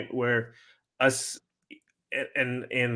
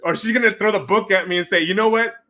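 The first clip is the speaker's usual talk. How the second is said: excited; 9 semitones higher, 6 dB louder than usual. Pretend. Acting out wife's reaction.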